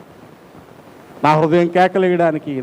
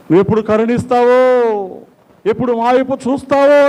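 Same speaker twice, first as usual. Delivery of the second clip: high, pleading call to God; anguished cry; 7 semitones higher, 4 dB louder than usual. Acted. Preacher asks God when mercy will come, anguished cry. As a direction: cry out in anguish, pitch raised. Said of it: "అని వేదనతో కేకలేస్తున్నావు".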